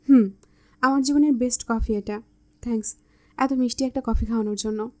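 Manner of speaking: usual speaking voice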